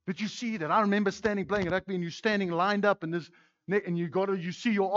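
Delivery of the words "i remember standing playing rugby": ordinary talk, nothing marked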